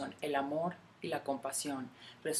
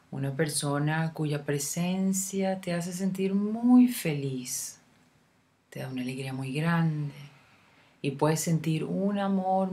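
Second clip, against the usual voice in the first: guided meditation; 4 dB louder than usual. Acted. Guide leading a listener through a love meditation, unhurried meditation voice. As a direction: lead the listener gently inward, phrase by phrase, leaving room to breathe.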